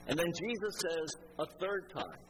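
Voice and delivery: ordinary talk, nothing marked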